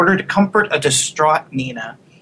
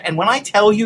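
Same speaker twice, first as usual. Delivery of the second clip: ordinary delivery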